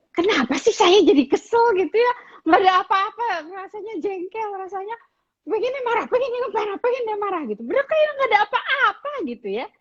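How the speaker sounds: put-on high, whiny exasperated teen voice; irritated teen. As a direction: play a moody teenager fed up for no reason. Teen venting sudden irritation.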